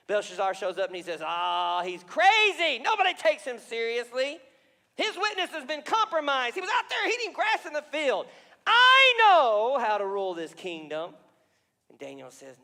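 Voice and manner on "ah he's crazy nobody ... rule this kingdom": higher, loud dismissive sneer; mock the old king, full of yourself; scoffing arrogant king. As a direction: Belshazzar scornfully brushing off warning. Belshazzar scoffing at Nebuchadnezzar.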